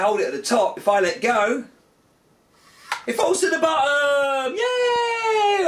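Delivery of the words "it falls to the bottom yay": cheering as if it were amazing; mock delight. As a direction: overplay the big reveal for the kids. Mock triumphant reveal to kids.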